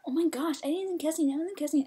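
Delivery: high, agitated mock denial; put-on high whine; whiny protest. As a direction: imitate a carded player protesting innocence. Imitating carded girl protesting.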